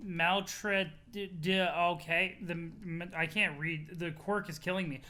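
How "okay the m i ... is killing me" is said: ordinary delivery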